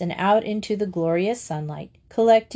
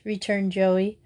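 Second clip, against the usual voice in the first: usual speaking voice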